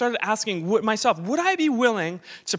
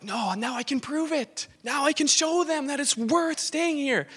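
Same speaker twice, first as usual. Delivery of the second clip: rising, eager proving-them-wrong voice; a hint of boastful triumph; triumphant inner voice; 6 semitones higher than usual. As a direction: lift it higher as you claim vindication. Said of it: "I'm like".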